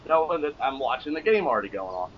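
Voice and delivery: normal talking voice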